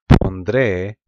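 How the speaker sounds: Pondré said loud, with the N stressed; Pondré pronounced with a clear nasal N; Spanish pronunciation. Shown as a demonstration. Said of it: "with the end, N in there".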